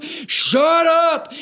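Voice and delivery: high mock rebuke; playful shut up. Preacher teasingly silencing a doubter, mock rebuke. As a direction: cut them off teasingly.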